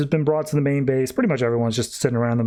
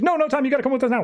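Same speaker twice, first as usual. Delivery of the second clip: rattled off very fast and high; super speed; 10 semitones higher, much faster than usual. Retold or imitated. Flash to Supergirl, a very fast and high rush. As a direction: blurt it all in one rushed breath. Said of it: "speaking at super speed".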